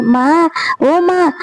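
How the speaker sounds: high, slow call across the house. A narrator's reading. Son calls his mother from afar, drawn out call.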